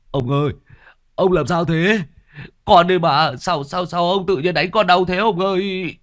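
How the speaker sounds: loud, high hurt yelping; pained servant cry. Acted. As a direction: cry out after being hit. Beaten servant cries out.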